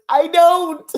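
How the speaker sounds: high, loud mock sob; mock crying. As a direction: wail it like fake crying. Imitating tearful reply.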